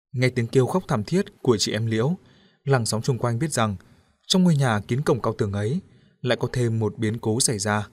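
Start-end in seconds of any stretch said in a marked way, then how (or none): none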